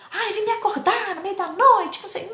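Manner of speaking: high, whiny put-on voice of a grumpy mom; imitating a mom complaining at midnight; mock annoyed mother. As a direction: mimic the irritated mother woken at night. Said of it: "Ela não gritou, ela não esperneou".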